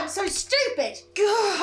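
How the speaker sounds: normal talking voice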